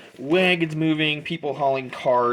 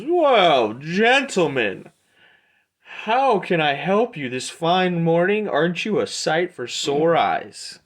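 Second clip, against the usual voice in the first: effusive merchant Aldor; 5 semitones higher, 3 dB louder than usual. Acted. Aldor greets the adventurers approaching his stall, effusive merchant voice. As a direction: hail the customers as a showy shopkeeper.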